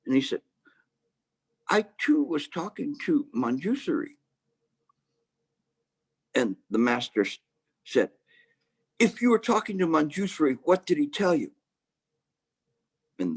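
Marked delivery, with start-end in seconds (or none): 1.7-4.2 put-on cheeky young monk. Monk copies master's excuse. 9.0-11.5 challenging master. Acted.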